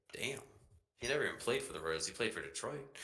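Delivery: ordinary delivery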